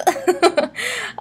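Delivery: high, light giggle at herself; laughing at her own stumble; amused laugh. Real, not acted. Tripping on eighth month, giggling.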